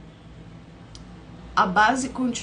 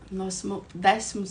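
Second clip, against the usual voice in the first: usual speaking voice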